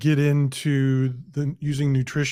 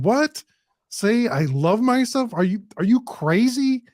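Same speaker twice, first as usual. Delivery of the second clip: higher, doubting mock protest; skeptical listener; 8 semitones higher than usual. Struggling listener scoffs at the podcast, higher and skeptical. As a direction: play the doubter scoffing at advice. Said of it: "talking to their phone right now going".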